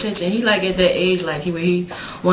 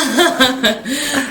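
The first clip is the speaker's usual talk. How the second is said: warm laugh at her son; 5 semitones higher, 5 dB louder than usual. Real, not acted. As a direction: laugh fondly. Laughing after baby imitation.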